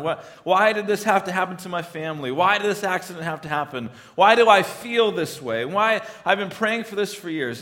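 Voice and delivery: high, insistent questions to God; frustrated pleading. Acted. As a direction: press each why in acted exasperation.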